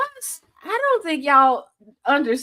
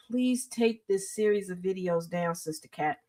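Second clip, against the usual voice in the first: low, soft aside; retold quiet plea; 5 semitones lower, 8 dB quieter than usual. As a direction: plead under your breath, voice dropping away. Retells own plea to a woman.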